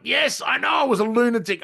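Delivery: high, loud, mock-exasperated yell; exasperated shout. Guest replaying his answer to trainer Trent, mock-exasperated high shout. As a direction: throw the admission back at him loudly. Retold reply to trainer's teasing.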